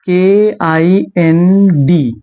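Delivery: letters I N D spelled out one by one, very slowly; slow spelling out. Shown as a demonstration. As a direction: spell each letter with long gaps. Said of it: "N D आ रहा है". (अक्षर धीरे-धीरे अलग अलग बोलें)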